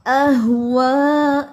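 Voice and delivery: अह वा very slow and loud, वा with rounded lips. Shown as a demonstration. Teacher correcting the वा sound, rounded and slow. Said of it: "होंठ गोल करके पढ़ना है इसको".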